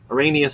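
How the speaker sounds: Arania as a-RAY-nia; ay pronunciation. Shown as a demonstration. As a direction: say Arania rhyming with "mania". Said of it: "which one I actually prefer".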